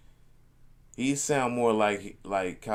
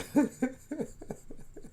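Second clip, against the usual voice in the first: a loose giggle, half out of it; delirious breathy laugh. Real, not acted. Laughing at himself to his viewers, delirious. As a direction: laugh like you're too tired to think straight. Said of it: "I'm delirious right now".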